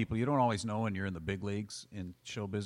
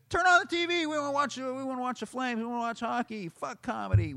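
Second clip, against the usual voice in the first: high, loud demanding shout; yelling hockey fans; 5 dB louder than usual. Retold or imitated. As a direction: bellow for the game back. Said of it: "they're yelling at him".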